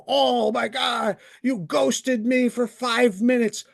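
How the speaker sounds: slow, high whiny mock of Tom; mocking whine. Ranting vlogger mocks Tom's complaint in a slow whiny voice. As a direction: mimic a petulant complaint, dragged out. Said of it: "drop the act of".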